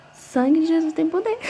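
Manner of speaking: high, startled cry of alarm; shocked exclamation. Retold or imitated. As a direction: gasp it out like you just saw something awful. Shocked by her own video. (exclamação assustada e bem aguda)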